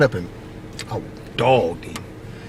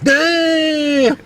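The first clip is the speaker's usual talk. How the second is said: shocked exclamation; 3 dB louder than usual. Retold or imitated. Clown friend reacts to punch.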